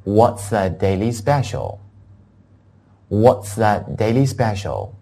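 slow, clearly spaced English question; deliberate teacher's model sentence; model reading. Shown as a demonstration. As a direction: say it slowly for learners. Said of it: "又有一句话可以供大家学习".

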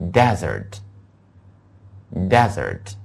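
desert, slow, stressed on DE-; stress on first syllable. Shown as a demonstration. Teacher contrasting word pairs: desert stressed at the start. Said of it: "重音放在D那个地方".

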